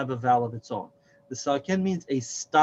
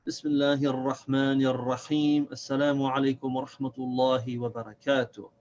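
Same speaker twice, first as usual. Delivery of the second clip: recited Arabic opening; much faster than usual. Acted.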